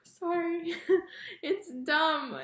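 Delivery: plain reading voice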